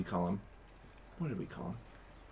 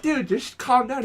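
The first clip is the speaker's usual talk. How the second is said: exasperated; 9 semitones higher than usual. Retold or imitated. Telling the panicking friend.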